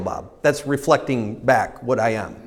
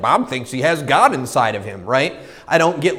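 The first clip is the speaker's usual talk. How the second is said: normal talking voice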